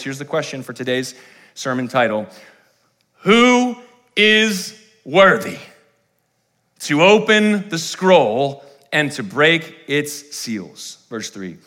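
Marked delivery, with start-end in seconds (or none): 3.2-11.0 slow, high, ringing proclamation; dramatic scripture reading. Acted. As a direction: draw out the angel's question like a herald. Reads the key verse dramatically.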